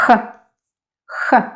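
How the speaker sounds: H with strong friction at the back of the mouth; strong Russian H. Shown as a demonstration. Teacher repeats the stronger sound for learners, strong Russian H. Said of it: "stronger than English H".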